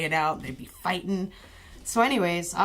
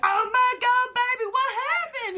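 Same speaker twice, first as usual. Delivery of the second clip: much higher, shrill panicked mother; hysterical mom; 4 dB louder than usual. Retold or imitated. Mom running out to her beaten son, high and panicked. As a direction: shriek it like a frantic mom. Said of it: "the mom comes running out".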